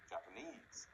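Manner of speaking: Japan-ESE, stressed at the end; final-syllable stress. Shown as a demonstration. Teacher modelling a word to repeat, last syllable stressed in Japanese. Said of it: "It can also be at the end".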